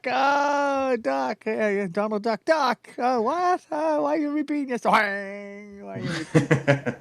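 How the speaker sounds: high cartoon mouse telling a joke; squeaky Mickey punchline. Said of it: "that's an old joke".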